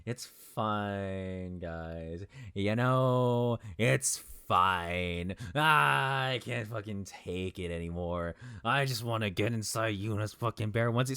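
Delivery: drawn-out theatrical despair; hammed-up wounded "I'm fine"; mock breakdown. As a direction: insist it through exaggerated wailing, stretching the words. Mock breakdown over co-hosts hating it.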